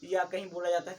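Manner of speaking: usual speaking voice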